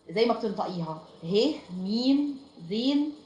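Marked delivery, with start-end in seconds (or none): none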